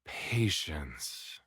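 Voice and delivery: calm yourself down under your breath; soft self-reminder. A narrator's reading. Narrator quietly tells himself to be patient.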